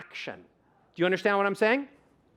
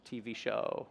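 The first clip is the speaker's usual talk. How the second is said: much softer and lower, no melody; flat inside voice; 7 semitones lower, 10 dB quieter than usual. Shown as a demonstration. Speaker demos how people talk without emotion. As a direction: drone it out like talking to yourself. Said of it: "we talk like this".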